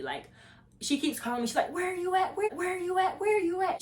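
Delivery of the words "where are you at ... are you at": high, insistent, repetitive nagging call; mock yelling friend. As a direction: imitate a friend yelling down the phone, pitched way up. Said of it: "she just yelling".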